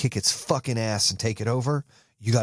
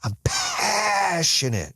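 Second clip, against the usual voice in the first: passionate shout; 7 semitones higher, much slower than usual. Shown as a demonstration. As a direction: bellow it from the chest, stretching every syllable. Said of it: "You feel that?".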